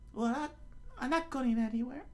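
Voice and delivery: put-on high falsetto girl voice; put-on high female voice, indignant; Nami. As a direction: try it in a squeaky female register. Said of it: "is that Nami's voice".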